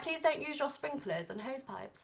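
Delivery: lightly hammed-up British accent; best British accent. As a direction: read it like a proper British notice. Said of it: "That was my best British accent".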